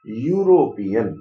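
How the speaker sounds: यूरोपियन drawn out loudly to show its first sound. Shown as a demonstration. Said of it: "उसकी आप sound देखिएगा".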